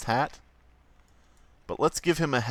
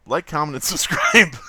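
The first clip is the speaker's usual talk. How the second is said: higher, breaking into laughter on the last word; breathless laughter; 9 semitones higher, 3 dB louder than usual. Real, not acted. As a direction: struggle to get the plug out through laughing. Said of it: "having trouble breathing through the laughter".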